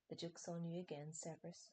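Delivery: ordinary delivery, nothing marked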